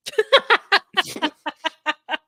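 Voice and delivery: high burst of laughter; real laugh. Real, not acted. Laughing at own joke about perfect relationships, real laugh. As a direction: laugh out loud in a long run.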